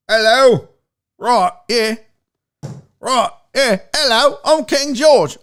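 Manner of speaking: hammed-up, bad British king; horrible British accent. Host plays King George to a British viewer, horrible British accent. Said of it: "I'm doing a horrible British accent".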